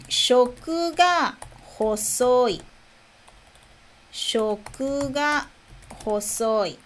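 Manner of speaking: "shoku ga hosoi" very slowly, with a pause after "ga"; measured dictation pace, each word held; slow model phrase. Shown as a demonstration. Teacher introduces an idiom to learners, "shoku ga hosoi" said slowly.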